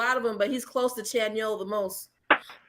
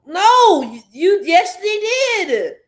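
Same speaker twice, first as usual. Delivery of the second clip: loud, heated rebuttal; indignant shout; 6 semitones higher, 8 dB louder, slower than usual. Real, not acted. Outraged rebuttal.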